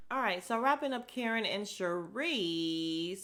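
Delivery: usual speaking voice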